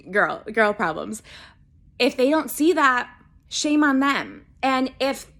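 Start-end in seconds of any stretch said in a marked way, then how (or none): none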